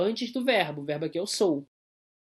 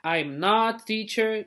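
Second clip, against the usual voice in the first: slow, careful English example; teacher modelling slowly; slow model sentence; 3 semitones higher, much slower than usual. Shown as a demonstration. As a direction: read it slowly for the class.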